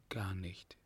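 very soft, breath-only reply; hushed answer of the wounded; faint whisper. A narrator's reading. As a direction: barely whisper it, weakly. Wounded woman answers lover faintly.

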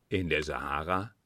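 astonished question, pitched low. A narrator's reading. Kunz questions his friend about the Sahara trip, astonished. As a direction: ask it low, in mild disbelief. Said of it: "fragte Kunz erstaunt".

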